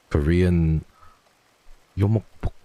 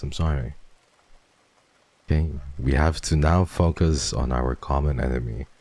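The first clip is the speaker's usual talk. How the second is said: usual speaking voice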